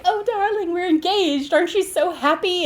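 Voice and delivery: high, sugary mock gushing from the fiancée. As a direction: gush like a giddy bride-to-be, pitched high. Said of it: "And she's like".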